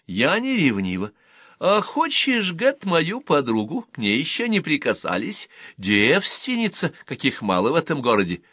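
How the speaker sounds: Рутта teasing Гэт with a sly offer. Acted.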